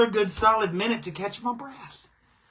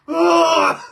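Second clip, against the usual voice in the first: normal talking voice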